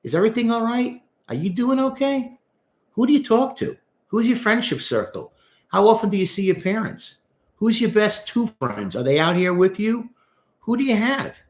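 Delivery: high, caring string of questions; concerned counselor questions. Acted. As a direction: check in on a client, voice lifted high. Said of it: "I would say to him".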